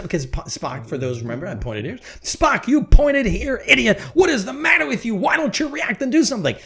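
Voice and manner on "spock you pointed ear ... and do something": hammed-up Kirk blowing his top; furious Captain Kirk. Kirk yelling at Spock as the planet blows up. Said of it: "And say".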